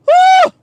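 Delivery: loud, high-pitched "woo"; sharp high cry of exasperation; exasperated whoop. Real, not acted. Exasperated outburst mid-rant.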